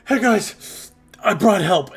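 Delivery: high, loud breathless shout as Cal runs up; excited shout. Acted. Guard captain running up shouts to the party, loud and high. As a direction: yell it out, high and bright with relief. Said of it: "He goes".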